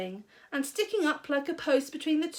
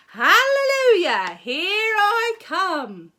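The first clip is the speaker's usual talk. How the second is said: much louder, higher, slow cackling shout; gleeful shout; 9 semitones higher, 10 dB louder, slower than usual. A narrator's reading. Grandma shouting as she bursts through the floor. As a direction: crow with mad delight. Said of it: "she shouted".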